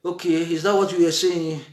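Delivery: calm her down with gentle agreement; agreeable husband. Retold or imitated. Husband agreeing calmly to settle the quarrel, low and gentle. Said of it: "The man will say".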